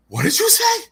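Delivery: high, loud, acted-out gasp of shock; shocked disbelief. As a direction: shoot way up, mock-aghast at what was heard. Acts out shock at mom's question.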